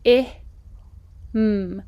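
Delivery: h, i, m said apart; segmented him. Shown as a demonstration. Teacher segmenting him. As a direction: sound it out. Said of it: "Try him".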